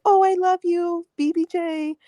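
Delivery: high-pitched cooing imitation of a doting owner; mock gushing. Mimicking a neglectful owner fawning on the cats, mock gushing. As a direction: put on a high, gushy pet-cooing voice.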